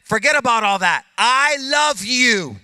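loud, slowing to a tender I love you; God answering the guilty heart. Acted. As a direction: speak as God, lifting them up. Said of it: "And God says".

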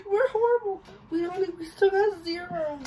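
laughing helplessly; words breaking into laughter. Real, not acted. Laughing game, keep losing.

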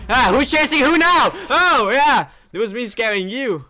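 high, loud gleeful yell; triumphant shout. Real, not acted. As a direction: crow over the monster. Chasing Freddy triumphantly.

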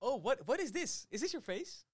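real delighted disbelief; surprised at the face on the card. Real, not acted.

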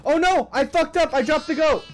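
high-pitched panicked outburst; oh-no panic over a blunder. Real, not acted. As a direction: blurt it out high in alarm. Panics after dropping goat.

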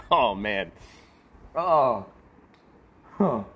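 ordinary talk, nothing marked

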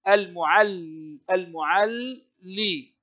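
slow split of the double lam in المعلي; still then vowelled lam. Shown as a demonstration. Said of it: "الأولى ساكنة والثانية متحركة".

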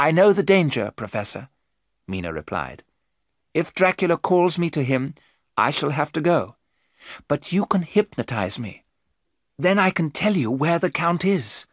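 a hint of a determined young woman; resolute, steady plea; lightly voiced Mina. Mina telling the professor she will come, resolute.

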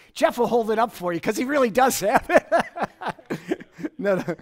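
teasing through a laugh; real laugh teasing Jeff in the room. Real, not acted.

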